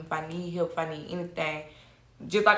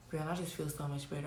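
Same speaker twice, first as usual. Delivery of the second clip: retold friend; 4 semitones lower, 15 dB quieter than usual. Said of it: "Dante literally called me".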